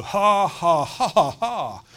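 loud, high, slow forced ha-ha; hearty laugh on purpose; laughing by faith. Shown as a demonstration. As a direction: push out a deliberate laugh. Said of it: "Sometimes you have to go".

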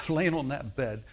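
ordinary delivery

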